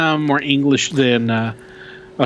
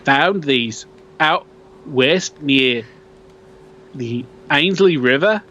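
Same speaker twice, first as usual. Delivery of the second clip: put-on Australian accent; 4 semitones higher than usual. Host reading a Yowie sighting post in a mock Australian accent. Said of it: "I think I'm getting better".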